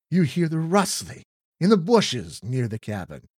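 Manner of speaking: normal talking voice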